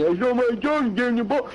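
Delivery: high-pitched mock whine. Host parroting the quarterback's stupid excuse, mocking whine. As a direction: mimic a complainer in a high, silly voice. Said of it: "comes out of your stupid mouth saying".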